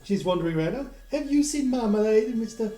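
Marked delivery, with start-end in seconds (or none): 1.1-2.7 high, loud neighbour asking after a cat; fretting neighbour. Acted. Francis McDonald asks Mister Laurent about the cat, fretting. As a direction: ask around in a raised, high-pitched voice. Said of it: "You hear Francis McDonald".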